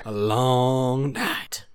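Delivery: very slow, drawn-out weary echo; long night dragged out slowly; slow drawn repeat. Pretend. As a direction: stretch every word in mock despair. Repeats slower.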